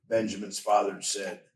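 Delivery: ordinary delivery, nothing marked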